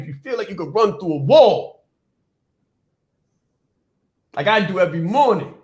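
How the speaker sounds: rousing motivational shout at the end; fired-up build. Real, not acted. Coach revving listeners to run through walls, fired-up build. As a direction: drive up to a loud peak on WALL.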